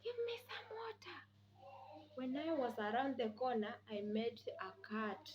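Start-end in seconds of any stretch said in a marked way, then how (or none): 0.0-1.2 breathy whispered plea for water. Retold or imitated. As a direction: whisper it like your last breath. Dying madam begs for water.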